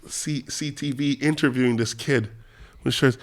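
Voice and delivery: ordinary talk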